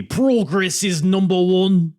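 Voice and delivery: high, loud, slow Liverpool boast; amateur Scouse wrestling-heel shout; Scouse accent. Acted. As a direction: crow it in hammed-up Scouse. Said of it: "I'm trying to become a Scouse here".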